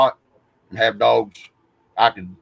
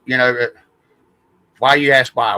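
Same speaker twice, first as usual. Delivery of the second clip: ordinary delivery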